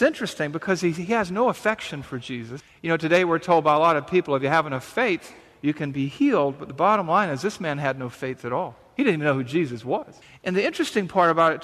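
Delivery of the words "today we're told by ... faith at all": usual speaking voice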